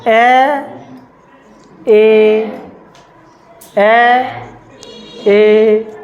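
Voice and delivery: ए and ओ held the same length each time; equal length vowels. Shown as a demonstration. Teacher asks students to hear equal timing. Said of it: "time बराबर लग रहा है".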